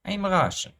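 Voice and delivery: high-pitched mock Russian accent; jokey, high Russian accent. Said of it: "the accents".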